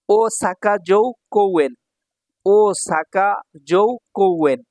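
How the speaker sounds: Osaka জো কোউয়েন slow, each word set apart; measured beats on Osaka জো কোউয়েন; syllable-by-syllable. Shown as a demonstration. Teacher sounds out place name.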